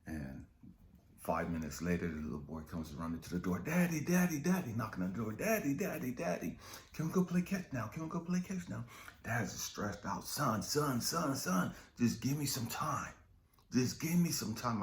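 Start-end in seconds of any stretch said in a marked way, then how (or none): 3.6-8.8 put-on high child's voice begging; eager little kid pleading to play; a small son begging his dad to play catch; little boy. 10.3-14.8 stressed dad. Retold or imitated. Stressed dad pleading with son.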